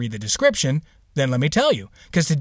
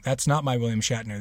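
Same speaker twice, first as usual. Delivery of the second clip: usual speaking voice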